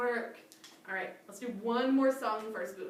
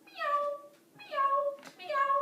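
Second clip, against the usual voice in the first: much higher sweet meows; cat meow; 4 dB louder, much slower than usual. Pretend. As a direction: mew like a little cat. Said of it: "we're gonna go".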